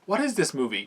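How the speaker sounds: baffled. Real, not acted. Reviewer reacting to a strange film clip, baffled. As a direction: ask it in real bewilderment.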